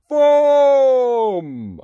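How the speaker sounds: high, loud, drawn-out boom; huge storybook booming syllable; loud giant noise. A narrator's reading. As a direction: blast out the giant's first sound. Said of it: "a loud".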